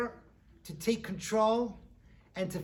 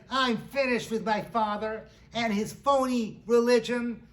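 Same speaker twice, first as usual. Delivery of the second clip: louder, higher indignant rant; angry daughter; 4 semitones higher, 5 dB louder than usual. Acted. Furious girl renounces her father, hammed-up anger. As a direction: punch out each grievance in mock outrage. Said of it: "She is so angry with her father".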